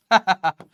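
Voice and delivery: short, loud chuckle in separate bursts; quick ha-ha-ha chuckle; amused laugh. Real, not acted. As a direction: laugh at your own teasing joke. Laughing at his own brain joke.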